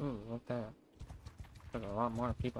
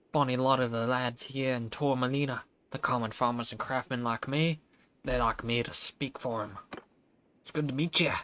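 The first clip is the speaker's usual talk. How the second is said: put-on Scottish accent; 5 dB louder than usual. Voicing the village elder Edwin in a hammed-up Scottish accent. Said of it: "the fuck's with the way this dude's talking".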